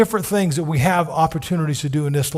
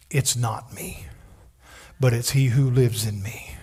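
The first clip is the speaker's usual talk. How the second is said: soft unvoiced whisper; whispered creed; 5 semitones lower, 4 dB quieter than usual. Real, not acted. Preacher sharing his core belief in a whisper. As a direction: whisper it like a secret truth. Key statement of faith.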